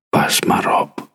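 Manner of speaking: hushed storybook warning; voiceless, secretive caution; whisper. A narrator's reading. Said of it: "fluisterde ze".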